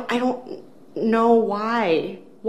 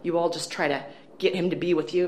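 ordinary talk, nothing marked

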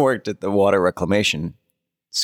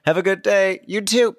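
fake cheerful; 11 semitones higher than usual. Mock cheerful work greeting.

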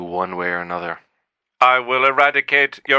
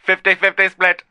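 robot voice; 8 semitones higher than usual. Acted. AI characters argue in voices.

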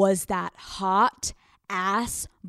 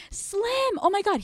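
very high, squealed greeting; feigned surprise; 12 semitones higher than usual. Acted. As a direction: act thrilled and amazed, way up high. Feigned delight at seeing him.

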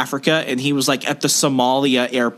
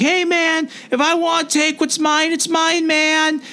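high, whiny stoner voice, much higher; mock hippie yell; 14 semitones higher than usual. Retelling the airport thief yelling at TSA in a high whine. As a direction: squeal it like an indignant burnout. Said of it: "he starts screaming at them".

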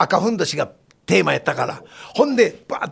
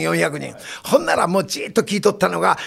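ordinary talk, nothing marked

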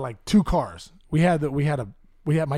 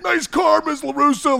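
Karate Kid bully mockery; 10 semitones higher, 6 dB louder, slower than usual. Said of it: "like the car from the Karate Kid".

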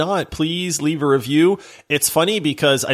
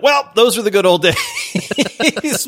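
mock nostalgia; 9 semitones higher, 3 dB louder, much slower than usual. Acts out his reaction to old game.

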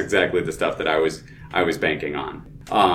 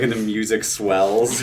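ordinary delivery

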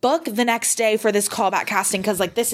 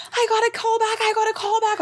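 much higher, giddy repeat; freaking out. Retold or imitated. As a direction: squeal it in disbelief. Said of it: "I'm like, freaking out".

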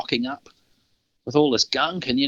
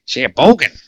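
put-on loud, higher stab at a Canadian accent, landing nearer New York; attempted Canadian accent; 4 semitones higher, 9 dB louder than usual. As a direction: have a bad go at a Canadian accent. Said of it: "try and do a Canadian accent here".

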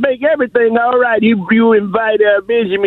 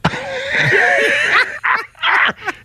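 high shrieking laugh; 3 dB quieter than usual. Real, not acted. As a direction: burst into a squealing laugh. Laughing at his own joke.

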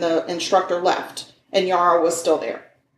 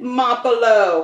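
Mapolo with a rolled Italian lilt, drawn out slowly; Italian accent; 4 semitones higher, slower than usual. Shown as a demonstration. Co-host asks for Mapolo in an Italian accent, hammed Italian. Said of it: "Say it in an Italian accent".

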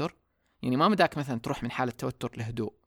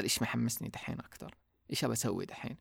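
soft, inward asking, much softer; quiet self-question; 8 dB quieter than usual. Retold or imitated. Asking herself softly what excites her now, quiet inward voice. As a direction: murmur the question to yourself.

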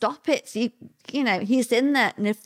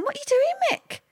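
much higher, puzzled call to him; high surprised question; faster than usual. Retold or imitated. Retelling calling to husband on wall.

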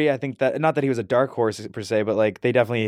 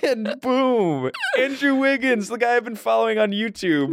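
high-pitched, thrilled announcement; giddy storytelling, pitched well up; excited reveal; 10 semitones higher than usual. Real, not acted. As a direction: land the big news with a high burst of excitement. Relives winning the first pick.

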